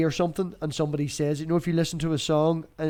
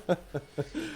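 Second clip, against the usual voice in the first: soft laugh; 2 semitones higher, 10 dB quieter than usual. Real, not acted. Laughs at own joke.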